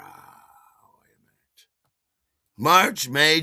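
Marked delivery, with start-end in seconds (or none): 0.0-1.7 breathy whispered aside. Real, not acted. Host catching a thought mid-show, whispering. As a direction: whisper it to yourself.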